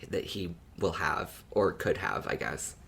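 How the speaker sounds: usual speaking voice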